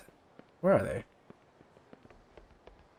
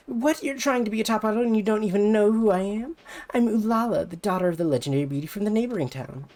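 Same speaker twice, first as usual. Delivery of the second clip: put-on high, pushy rival-girl voice; higher, brassy voice of a jealous idol; bratty rival idol; 10 semitones higher, 5 dB louder than usual. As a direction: talk down to her like a snobby idol. Ulala boasts.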